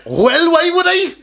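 high, slow, sing-song mock Kerry accent. Answering a request for Kerry, high caricatured Kerry accent. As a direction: ham up a broad Kerry accent. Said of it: "That's Kerry".

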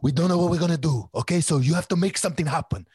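loud, high, fast Italian-New Yorker voice; hammed-up intense director, pushing hard; over-the-top frantic director urging him on; impression of intense director. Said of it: "He spoke like this all the time".

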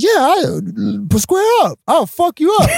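fight challenge. Retold or imitated.

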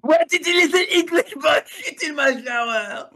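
put-on high, fast, thick French accent; hammy French accent, half laughing. Mocking French exchange student.

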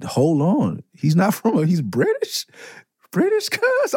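high-pitched, incredulous double take; retold disbelief. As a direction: replay your stunned discovery, voice climbing very high. Retells shock at British accent.

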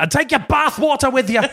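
very high, hammed-up send-off; comic parting yell; mock outrage. Brother throws out the baby, high mock outrage.

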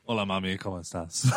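low Spanish voice with a laugh in it; hammy low Spanish greeting; Spanish accent. Acted. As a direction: play a Spanish speaker, amused. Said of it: "he's Spanish now".

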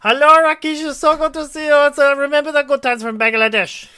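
very high, loud mock Indian accent; put-on Indian accent. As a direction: play around in a hammed-up Indian accent. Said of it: "done a little accent or played around".

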